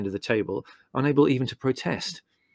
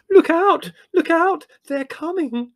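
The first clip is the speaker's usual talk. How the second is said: very high, piercing scream of alarm; Margot; 7 dB louder, slower than usual. Acted. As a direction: scream the warning in panic, far above your usual pitch. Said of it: "screamed Margot".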